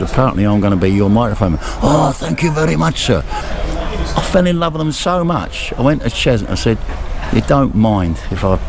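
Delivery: ordinary talk, nothing marked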